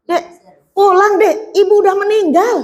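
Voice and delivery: urgent plea. Acted. Relative begs the young wife to come home, rising plea. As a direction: urge her home, voice rising with the bad news.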